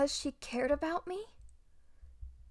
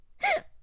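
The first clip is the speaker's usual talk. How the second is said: rueful laugh; 4 dB louder than usual. Acted. Rueful laugh over lost master.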